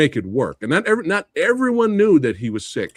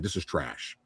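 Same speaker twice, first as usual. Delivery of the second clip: mild mock grumble, much softer and lower; mock disgusted mutter; 12 semitones lower, 14 dB quieter than usual. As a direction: toss it off with quiet contempt. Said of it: "He was like".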